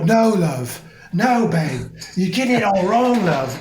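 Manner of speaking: nineties photographer. Pretend. A nineties photographer directing his model, loud and bossy. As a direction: play a camp, pushy photo shoot director.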